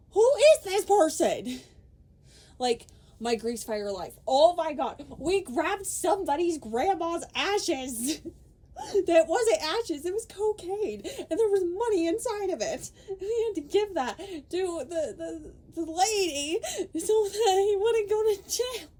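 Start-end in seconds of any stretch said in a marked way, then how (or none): 0.1-1.6 loud, high outburst; loud baffled who is this person; exasperated shout. Real, not acted. 4.3-18.9 loud, high, gushing recap; high loud excited retelling breaking into laughter. Real, not acted.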